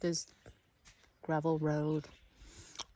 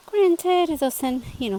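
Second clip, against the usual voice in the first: excited; 9 semitones higher, 9 dB louder than usual. Real, not acted. Gushing over fairy village.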